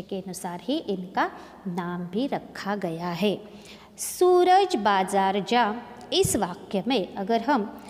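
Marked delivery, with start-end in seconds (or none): 4.0-5.7 Suraj with सू drawn out, slow; slow stressed reading. Shown as a demonstration. Teacher reads the sentence to show the long ऊ, slowly. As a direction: lean on सू in Suraj. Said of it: "इस प्रकार से हम इसका वाचन करेंगे".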